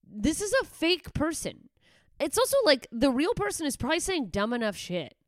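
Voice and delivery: almost shouting in disbelief; exasperated. Real, not acted. Exasperated rant peak.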